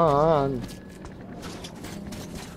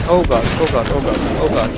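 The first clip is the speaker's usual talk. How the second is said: panicked; 6 dB louder than usual. Real, not acted. Panics during attack.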